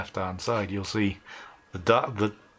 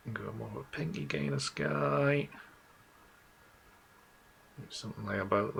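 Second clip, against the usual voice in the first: whispering; 7 dB quieter than usual. Real, not acted. Muttering to himself while editing.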